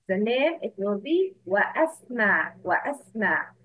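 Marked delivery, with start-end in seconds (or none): 1.5-3.6 "wa asma" with the hamza clearly sounded after "wa"; hamza pronounced. Shown as a demonstration. Teacher asks students to hear the hamza, sounded in "wa asma". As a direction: keep "wa" and "asma" apart with a glottal catch. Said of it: "It's a hamza qata".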